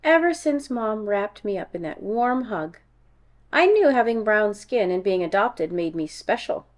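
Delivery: ordinary delivery, nothing marked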